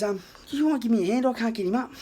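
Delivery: put-on high-pitched old fellow timidly asking a favour; put-on polite voice, quick and high; polite old man. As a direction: imitate a courteous old man needing a hand. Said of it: "so polite spoken".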